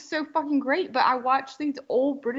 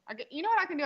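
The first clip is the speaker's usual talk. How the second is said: normal talking voice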